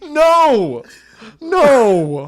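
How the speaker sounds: loud, high, drawn-out refusal; mock horrified cry. Comic reacting to a painting of a king, mock horrified cry. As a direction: wail it out in hammed-up horror.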